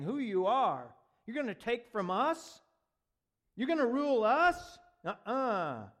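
a hint of scornful priests; mocking priests. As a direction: sneer it as the jealous leaders. Voicing envious priests mockingly.

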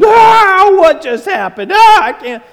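overwhelmed half-laughing shock; freaking out. Retold or imitated. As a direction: disciples losing it, yelping in awe. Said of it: "coming apart at the seams".

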